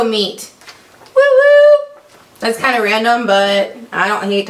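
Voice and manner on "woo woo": very high, loud whoop; excited cheer. Real, not acted. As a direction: whoop for joy. Cheering over free taco meat.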